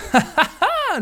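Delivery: high-pitched evil cackle on request; a short villainous laugh, hammed up; [name] evil laugh. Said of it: "Tu veux que je fasse un petit rire maléfique?". (petit rire maléfique aigu)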